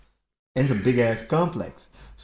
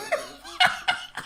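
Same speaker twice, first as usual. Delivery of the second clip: high laugh. Real, not acted. Laughing at the story.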